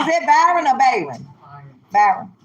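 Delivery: ordinary talk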